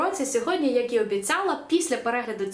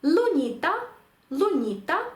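«Луніта» modelled as lu-NI-ta, pitched up; penultimate stress; 5 semitones higher, slower than usual. Shown as a demonstration. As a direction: stress the middle syllable of «Луніта». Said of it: "та сама ситуація".

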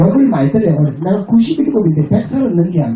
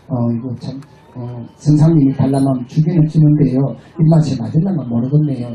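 Chungcheong drawl; 6 semitones lower than usual. Retold or imitated. Imitating Chungcheong women answering a teacher, Chungcheong accent. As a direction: hedge it slowly like a Chungcheong woman. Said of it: "충청도 여자들은".

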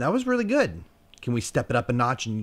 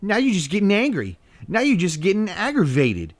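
higher, louder mock exasperation at the dog; exasperated; 10 semitones higher, 7 dB louder than usual. Real, not acted. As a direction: call the dog out, amused and loud. Scolding the barking dog.

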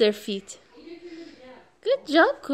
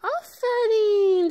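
drawn-out praise; 6 semitones higher, slower than usual. Real, not acted. Praising the toddler.

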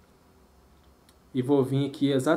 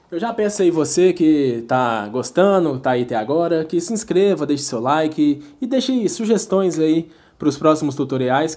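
youtuber outro pitch; 7 dB louder than usual. Acted. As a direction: push the like and subscribe hard. Loud call to subscribe.

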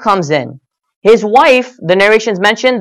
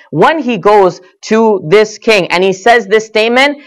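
ordinary talk, nothing marked

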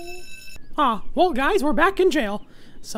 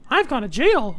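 ordinary delivery

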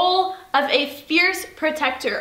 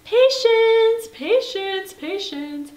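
put-on toddler voice; 5 semitones higher, much slower than usual. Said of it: "she starts going".

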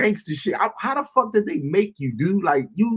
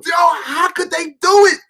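amazed shout; 10 semitones higher, 4 dB louder than usual. Real, not acted. Awed by alien technology.

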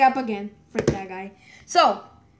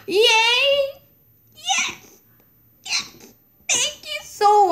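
high-pitched squealed cheer; excited squeal; 13 semitones higher, slower than usual. Real, not acted. Gamer cheering at the screen, squealing excitedly. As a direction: squeal your thanks in delight. Squeals, then abruptly takes it back.

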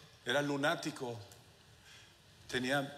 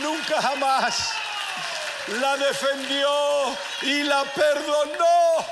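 rising to a loud, high preacher's cry; preaching at full force; 9 semitones higher, 8 dB louder than usual. Acted. Preacher to the church about the despised woman, in full-force shouting. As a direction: preach it louder and louder, slowing on the climax.

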